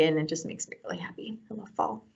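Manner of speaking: soft hushed whisper; quiet dreamy whisper of love for fall. Real, not acted. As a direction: breathe it out like a happy secret.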